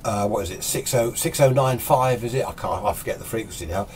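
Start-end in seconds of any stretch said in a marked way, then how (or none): none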